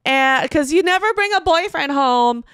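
put-on high, loud, slow drunk-mom toast; imitating tipsy mom. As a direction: ham up the tipsy mother's speech. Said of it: "And it's my mom".

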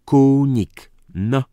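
KUNIK loud, high and drawn out; shouted demand. A narrator's reading. Count orders Dyzma to repeat the name, shouting. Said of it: "Powtórz pan zaraz".

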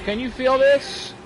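patronizing high coo, pestering the robot; mock baby talk. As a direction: talk down to him like a toddler. Teasing the robot Carl in-game.

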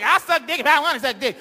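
put-on defiant teen yelling. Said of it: "she's like".

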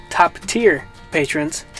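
ordinary talk

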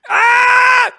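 high, loud cackling laugh; big shrieking laugh; loud burst of laughter. Real, not acted.

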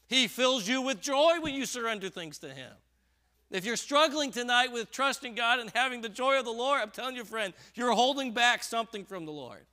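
loud, high, driving sermon voice; preaching at full force. Real, not acted. Preacher urging his flock, in a loud high push. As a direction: press the congregation hard with rising force.

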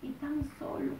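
whispered, close to tears; tearful whisper. Retold or imitated. Crowd members whisper through tears to the preacher. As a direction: breathe it out like a crying penitent. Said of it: "Todos lloraban y decían".

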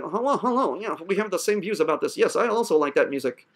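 low, soft, stilted friendliness; mock polite small talk. Teacher parodying a bland new friend, mock polite small talk. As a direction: put on dull, agreeable stranger talk. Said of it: "Is that how you want me to talk back to you?".

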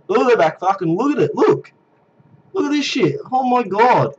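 excited. Real, not acted. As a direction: shout it out in amazement. Amazed by the mage crowd.

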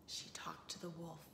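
whispered aside; whispering. Real, not acted. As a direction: breathe it as a secret. Teacher whispers in retell.

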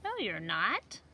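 lively seed voices for a picture book; talking seed characters. Acted. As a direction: voice the little seeds for kids. Seeds talk in picture book.

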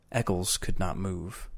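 ordinary delivery, nothing marked

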